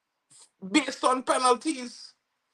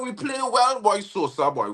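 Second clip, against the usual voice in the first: normal talking voice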